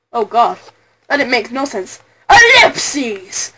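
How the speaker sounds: ordinary talk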